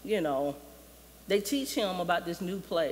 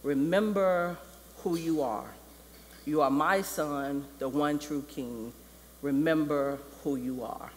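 Mufasa; 3 semitones lower than usual. Retold or imitated. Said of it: "And Mufasa just says".

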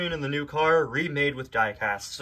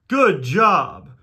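loud, high cartoon congratulation; hammed-up cheer; acted praise; 4 semitones higher, 6 dB louder than usual. Friends congratulate in story.

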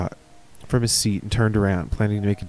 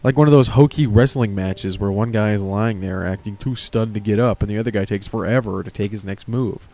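ordinary delivery

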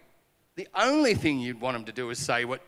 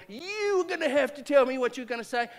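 high, pushy sulky challenge; petulant demand; 11 semitones higher, 5 dB louder than usual. Retold or imitated. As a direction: demand it like a stubborn kid. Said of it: "it was gonna be that petulant".

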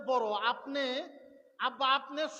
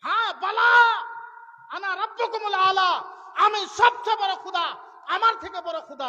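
roaring, much louder and higher king's boast; tyrant's boast; 7 semitones higher, 9 dB louder than usual. Acted. Pharaoh roars at his daughter that he is the highest lord. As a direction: bellow it like a proud tyrant, last words slow.

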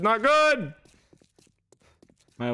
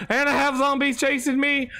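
usual speaking voice

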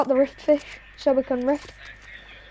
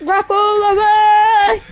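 excited shout; 7 semitones higher, 7 dB louder, much slower than usual. Real, not acted. Shouting a command mid-fight.

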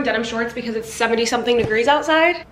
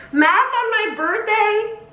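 high, slow outraged question; mock outrage; 10 semitones higher, much slower than usual. Real, not acted. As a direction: play up the horror of math.

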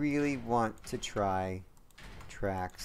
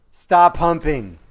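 slow, much louder, each word punched; title announcement; 5 semitones higher, 14 dB louder, slower than usual. Real, not acted. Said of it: "a video just called".

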